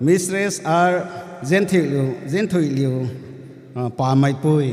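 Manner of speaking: formal roll-call announcement; calling out names. Real, not acted. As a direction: call the next name out clearly.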